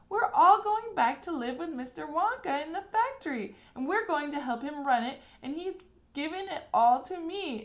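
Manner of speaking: high, loud tumbling rush of news; blurting out the good news, breathless; excited. A narrator's reading. Charlie bursting with news to mother.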